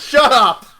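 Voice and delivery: loud, curt shout; sharp command. Retold or imitated. Female officer yelling at her partner, loud and curt. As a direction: shut him down hard. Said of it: "And she goes".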